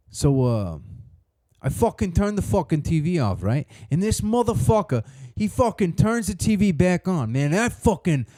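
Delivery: angry Italian friend. Retold or imitated. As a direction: rant like a fuming Italian-American buddy. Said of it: "when they get mad, they get twice as an Italian".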